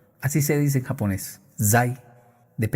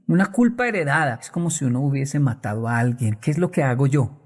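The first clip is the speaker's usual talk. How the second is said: normal talking voice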